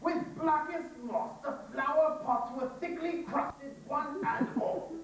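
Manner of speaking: slow, each word clear as a bell; poem read precisely; slow clear recitation. Shown as a demonstration. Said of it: "Each word clear as a bell".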